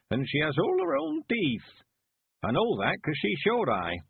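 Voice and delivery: put-on a crafty old villager scheming to wed; creaky-high rustic old man, pleased with his plan; old Mister Weevil. A narrator's reading.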